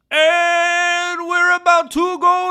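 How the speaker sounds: high, loud, drawn-out hype call; slow announcer-style build to the start; hype announcement. Real, not acted. Streamer announcing to chat that they're going live, hyped.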